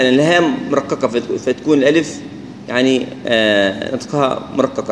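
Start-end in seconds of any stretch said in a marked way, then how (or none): none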